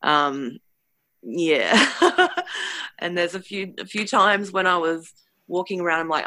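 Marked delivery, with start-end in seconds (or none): 1.8-2.9 short high-pitched laugh. Real, not acted. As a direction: break into a real, high laugh. Own laugh after telling it.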